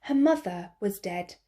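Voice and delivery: ordinary delivery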